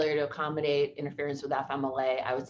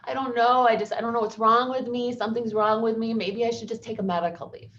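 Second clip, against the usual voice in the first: retold sobbing; 6 semitones higher than usual. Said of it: "She's sobbing".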